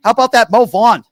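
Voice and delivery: loud, high-pitched mock Boston accent. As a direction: belt it out like a rowdy Boston local. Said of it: "I love your Boston accent".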